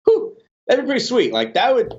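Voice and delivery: picture the money landing and light up; excited. Retold or imitated. Host retelling his hopeful inner voice, excited and high. Imagining hitting fifteen K.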